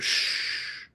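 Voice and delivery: hush. Retold or imitated. Guru hushing angry disciples.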